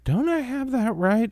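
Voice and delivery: mock-aggrieved Trump; Trump impression. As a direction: do a whiny Trump. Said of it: "Trump was always like".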